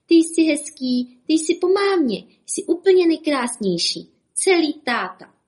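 high, loud doting praise; proud cooing. A narrator's reading. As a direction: gush over the newborn. Proud parents cooing over baby. (rozplývání nad miminkem)

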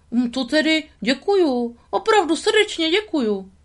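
high, beaming beaver thanks; grateful beaver voice. Acted. The beaver heartily thanks Tramp and Lady. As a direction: gush your thanks like a happy critter.

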